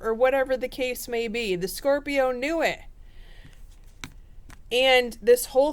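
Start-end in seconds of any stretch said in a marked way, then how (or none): none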